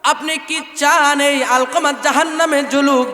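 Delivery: loud, high pleading over music; pleading preacher. Acted. Prophet begging the mother to forgive her son. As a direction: plead hard for the son's forgiveness.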